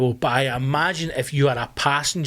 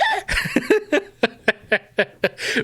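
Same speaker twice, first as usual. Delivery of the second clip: real laughter. Real, not acted. Laughing at his own story.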